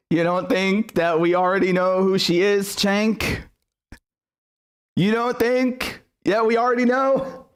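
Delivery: mocking incredulity. Real, not acted. Streamer taunting Cenk over his post, high mocking incredulity.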